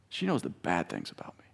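hushed confiding whisper. Acted. Preacher confiding to the congregation about his wife, whispered. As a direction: let it drop to a whisper.

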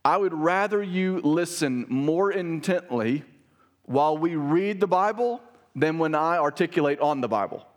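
emphatic preacher's appeal, measured and deliberate. Real, not acted. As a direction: weigh out each phrase so it lands. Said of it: "I'm gonna say this emph-, emphatically".

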